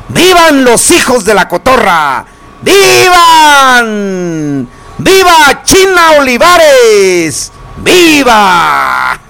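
high, long drawn-out cheers; stretched festive cries, falling at the end; festive viva shouts. Acted. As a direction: call out toasts like a fiesta announcer. Radio host calling out vivas.

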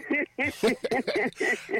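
high burst of laughter; laughing at the caller's slip. Real, not acted. Host laughs at caller's mistake.

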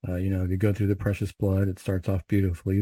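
normal talking voice

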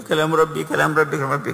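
mock-tearful high voice, lightly done; high, pleading repetition with a touch of weeping; imitated weeping. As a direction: imitate a man overcome with tears, pitched high. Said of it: "يبكي، يقول".

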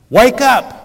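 loud, high shout; shouted wake-up call. Acted. Preacher shouting to his congregation, loud and high. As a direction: yell it to wake the room.

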